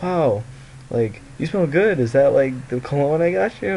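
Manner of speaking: high, loud gushing compliment; imitated impressed secretary. Secretary asking about her gift, mock gushing surprise. Voices secretary admiring his cologne.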